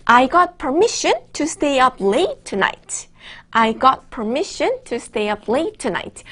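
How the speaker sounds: slow, careful model reading; model sentence read slowly. Shown as a demonstration. Teacher reading the example slowly for students. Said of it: "문장을 이렇게 만들었어요".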